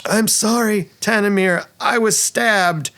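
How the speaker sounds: high, hammy dying apology; wounded halfling actress. Acted. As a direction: apologise like a stage heroine falling wounded. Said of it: "she falls back and says".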